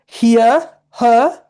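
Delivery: high, loud posh British imitation; British accent. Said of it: "You be like".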